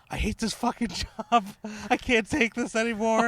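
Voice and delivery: high-pitched mock meltdown, half laughing; retold outburst. Teller mimics the grocery kid's meltdown, high and mocking. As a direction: play the grocery kid losing it, cracking up. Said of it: "he was like".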